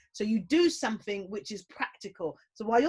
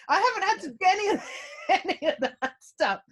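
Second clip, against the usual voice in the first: giggling through the words, higher and fast; laughing; 8 semitones higher, 4 dB louder, faster than usual. Real, not acted. Wife laughing about never buying toilet roll, higher and giggly. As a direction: laugh it out, can't keep a straight face.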